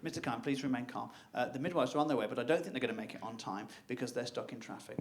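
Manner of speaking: low, soft, very composed midwife; a calm professional who has seen it all. Retold or imitated. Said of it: "very calm, very collected".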